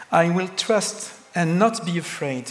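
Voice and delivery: ordinary delivery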